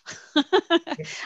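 high burst of laughter; real high-pitched laugh. Real, not acted. Joking after shameless plug.

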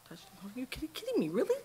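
mock disbelief at a child who won't stop; incredulous exasperation. Mother to her toddler at the TV, incredulous. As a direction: pitch leaps high on kidding me, then really.

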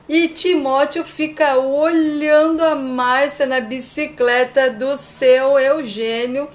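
ordinary delivery

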